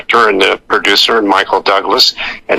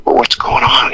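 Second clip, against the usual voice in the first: hushed, voiceless whisper; whispered aside. Retold or imitated. Actor asks castmate Chris what is happening, whispered aside. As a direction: whisper it to a colleague.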